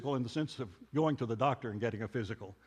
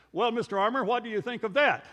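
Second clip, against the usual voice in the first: imitating a smug professor, higher and louder; 6 semitones higher, 7 dB louder than usual. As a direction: throw down a self-satisfied challenge, raised voice. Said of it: "sort of smugly said".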